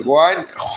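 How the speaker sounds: "গয় খ" articulated from the throat; throat letters. Shown as a demonstration. Teacher showing where ghain forms, throaty "গয় খ". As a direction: bring "গয় খ" out of the throat, each separate. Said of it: "মুখের পাশে যে গলা আছে এখান থেকে".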